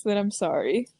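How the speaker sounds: ordinary talk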